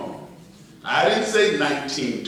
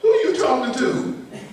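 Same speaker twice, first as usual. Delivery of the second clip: higher, laughing mock indignation; indignant retort; 7 semitones higher than usual. Preacher retorting to an overfamiliar child, mock indignant. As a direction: put the kid in his place, half joking.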